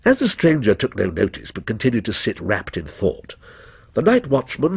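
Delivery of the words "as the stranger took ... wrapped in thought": usual speaking voice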